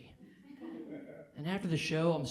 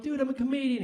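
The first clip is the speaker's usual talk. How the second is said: pitched up high and a bit louder, pleading; high nervous protest; 10 semitones higher, 5 dB louder than usual. Retold or imitated. Comic to angry heckler, high nervous protest. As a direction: squeak it out like a scared guy trying to sound tough. His answer to heckler, retold.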